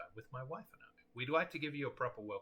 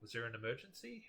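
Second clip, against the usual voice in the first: normal talking voice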